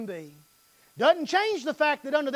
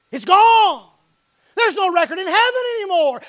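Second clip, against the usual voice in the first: voicing the Lord; 8 semitones higher, 11 dB louder than usual. The Lord voiced replying.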